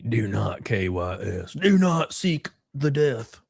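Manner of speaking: slow, low, put-on solemn voice; mock solemn warning. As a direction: intone it slowly like a grave oracle.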